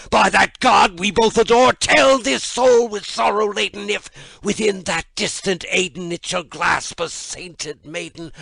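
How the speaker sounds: high, loud anguished outcry; frantic shout. Acted. Narrator shouts at the raven, frantic and anguished. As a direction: scream it at the bird in anguish. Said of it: "said I".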